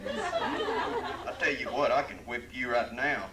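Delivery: high, loud, slow cowboy threat through laughter; laughing cowboy threat. Acted. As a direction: threaten him while cracking up. Cowboy threatens New Yorker, laughing.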